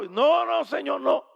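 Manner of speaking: high, shouted refusal; emphatic refusal. Retold or imitated. Wife refusing the stranger's prayer, emphatic shout. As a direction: cut him off loudly.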